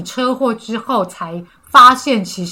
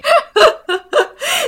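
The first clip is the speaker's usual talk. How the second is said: high, delighted laugh. Real, not acted. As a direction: laugh at the sweet ending. Host laughs at couple story.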